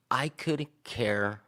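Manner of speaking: slow, drawn-out start of an angry retort. Retold or imitated. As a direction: drag the words out, holding back anger. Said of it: "I, I lost it".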